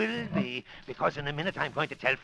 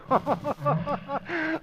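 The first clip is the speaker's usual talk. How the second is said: high, mad villain cackle; a high mocking villain laugh; gloating laugh; 9 semitones higher than usual. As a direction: cackle with cruel delight. Villain gloats before killing.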